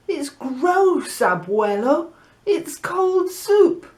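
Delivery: put-on high, slow little-girl voice, disgusted; a little girl complaining, drawn out and high; whiny little girl. Anna complains to her grandfather.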